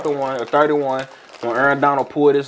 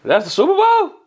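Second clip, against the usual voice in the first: incredulous; 8 semitones higher, 3 dB louder than usual. Retold or imitated. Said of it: "I'm like".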